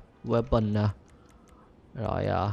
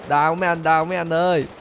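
higher, louder teasing call; loud taunt; 4 semitones higher, 5 dB louder than usual. Real, not acted. Taunting the police, loud teasing. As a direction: bait them.

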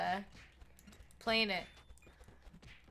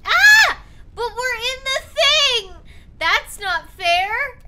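shouting, much higher, slowing at the end; raging gamer; 13 semitones higher, 18 dB louder, slower than usual. Real, not acted. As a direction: yell the protest at the game. Gamer rage at unfair hit.

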